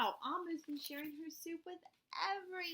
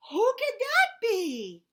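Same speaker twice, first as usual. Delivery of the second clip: much higher and louder, laughing wonder; surprised; 8 semitones higher, 15 dB louder than usual. Acted. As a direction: exclaim in delighted surprise. Amu surprised at knock.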